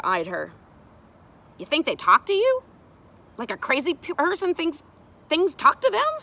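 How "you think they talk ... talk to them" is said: high, loud incredulous question; sceptical child. Acted. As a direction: challenge it like a doubting kid. Child sceptical about talking wood.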